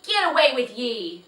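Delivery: loud shouted dismissal; angry shout. Acted. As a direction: shout him off the land. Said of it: "shouted Mister Nye".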